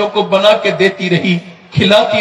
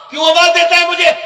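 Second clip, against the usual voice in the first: much higher, fast questioning mother's voice; mother's reply; 11 semitones higher, faster than usual. Acted. Mother asks her son why he calls, high quick voice. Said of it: "पूछेगी".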